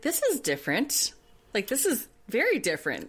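high, sing-song mock disbelief; mock surprise. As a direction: act politely stunned by the taste. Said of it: "I was like".

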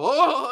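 loud, high mock cry of alarm; imitated fleeing demons. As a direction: mimic startled demons about to bolt. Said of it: "if the demons in your child go".

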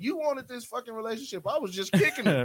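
high, loud mock outrage at a partner; indignant complaint. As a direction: protest hotly, playing up the grievance.

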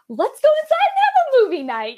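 high, breezy let's-move-on voice; brushing it off. Retold or imitated. Mom brushing past son's grief with a breezy high offer. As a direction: chirp it to skip the hard moment. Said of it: "and be like".

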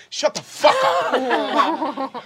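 high, drawn-out yell; loud hammed-up retort breaking into laughter; angry comeback shout. Player shouts back in character.

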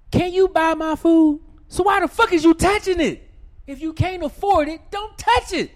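high, loud indignant shout; ranting at the date about her touching his food; angry shout. Real, not acted. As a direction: hammer the point at full volume. Rant climax.

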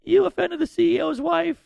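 high, loud, tearful panic; mock crying. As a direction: imitate a hysterical crying producer. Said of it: "the lady's crying".

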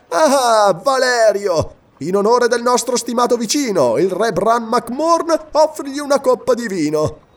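high, loud and jovial; cheerful tone. A narrator's reading. Roman orders wine in the prisoner's honour, cheerful tone. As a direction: hail the officer with mocking good cheer. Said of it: "disse in tono allegro Silla".

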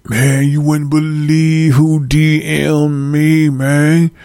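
drawn-out excited boast; excited bragging. Acted. Fan bragging about who messaged him, mock excited. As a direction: play up being excited, stretching the words. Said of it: "y'all be excited".

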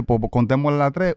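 usual speaking voice

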